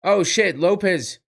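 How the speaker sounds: excited fight reaction. Real, not acted. Fan reacting live to a fighter landing big strikes, excited. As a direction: react live as the punches land.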